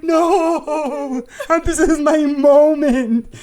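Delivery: very high, drawn-out comic protest; mock desperate wail. Retelling being refused by a star, in a mock desperate wail. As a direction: wail it slowly as if heartbroken, hammed up.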